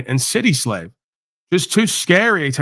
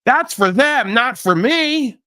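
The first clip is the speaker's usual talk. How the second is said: much higher, slow, prissy mock voice; smug mock suburbanite; 9 semitones higher, slower than usual. Mocking comfortable suburbanites who think jail is for others, high slow prissy voice. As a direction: drawl it out like a sheltered snob. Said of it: "seemingly born into jails".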